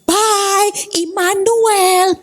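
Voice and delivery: high, bright drawn-out farewell; cheerful goodbye. Retold or imitated. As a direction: sing out "Bye, Immanuel" slowly and sweetly. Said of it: "Saya cuman gini".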